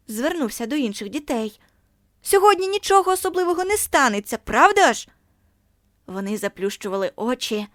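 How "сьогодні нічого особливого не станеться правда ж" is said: much higher, loud sneering question; mocking. A narrator's reading. Bully mocking Margo to the other children, high sneer.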